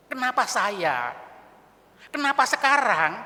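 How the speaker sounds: loud, drawn-out cry to God; acted bereaved outcry, rising in force; anguished questioning. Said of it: "itu seringkali bergumul ya".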